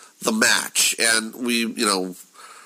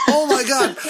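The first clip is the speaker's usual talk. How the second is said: high and louder, thrilled; excited gasp; 13 semitones higher, 3 dB louder than usual. Retold or imitated. Fan hearing the eighties songs, thrilled. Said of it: "I'm like".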